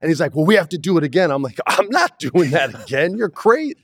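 high, mock-outraged protest; indignant retort. To the director after the dinghy stunt, indignant and high. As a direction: push it up into disbelieving outrage.

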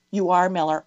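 ordinary talk, nothing marked

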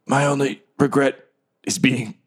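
lightly dramatic last words; dying words. Acted. Dying guard's hammed last line, lightly voiced. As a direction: gasp out a final regret. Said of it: "he's like".

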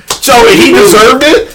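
loud, high incredulous question; indignant disbelief. Real, not acted. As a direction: challenge them, pitch shooting up. Heated argument over a crash.

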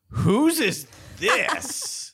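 high, very slow, loud drawn-out question; hammed-up shocked celebrity; incredulous celebrity. Celebrity grabs phone, retold.

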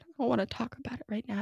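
put-on sulky quiet child. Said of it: "And she said".

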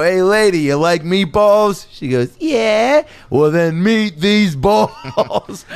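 loud, high, slow Italian-American delivery; Italian-American comic. Retold or imitated. Comic hitting on a lady with a loud Italian-American line. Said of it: "You go".

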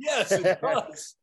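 slow, laughing repeat; amused slow insistence. Real, not acted. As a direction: drag it out through a laugh. Laughing repeat of his retort.

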